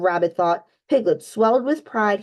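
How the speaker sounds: plain reading voice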